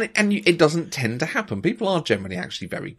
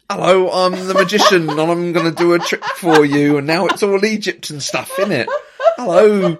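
loud, laughing impression of a London accent, higher; mock London accent; 5 semitones higher, 8 dB louder than usual. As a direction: ham up the London accent like a showman magician. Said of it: "it reminded me of a vision".

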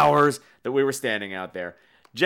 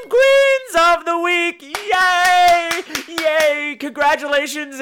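high, loud and slow gushing cheer; hammed-up award-show excitement; excited announcement; 7 dB louder, much slower than usual. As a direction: crown them with a big showy cheer. Crowning the week's winners.

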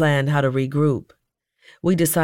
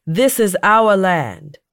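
loud, high, slow declaration; raised voice, drawn-out and defiant; defiant retort; 4 semitones higher, 5 dB louder, slower than usual. A narrator's reading. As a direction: retort it forcefully, stretching the words. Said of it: "The activists outright retorted".

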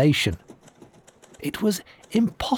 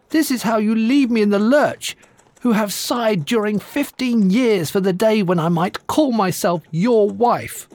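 angry giantess; 7 semitones higher than usual. Acted. Jilted giantess confronts groom.

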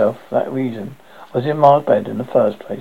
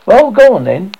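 louder and higher, impatient push; mother hurrying her son down for tea, loud; loud prompting; 7 semitones higher, 9 dB louder than usual. Acted. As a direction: chivvy him along, loud and sharp. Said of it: "she prompted".

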